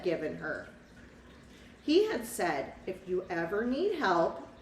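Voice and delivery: put-on lively read-aloud for a child; picture-book narrator voice; storybook narration. A narrator's reading.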